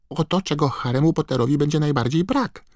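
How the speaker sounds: very high, squeaky elf urging; squeaking house-elf. A narrator's reading. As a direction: squeal at him to hurry. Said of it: "pisnął skrzat". (piskliwy, ponaglający głos skrzata)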